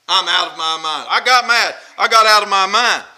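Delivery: mildly mock-angry, forceful delivery; acted anger. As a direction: imitate someone who has lost their temper. Voicing someone mad out of mind.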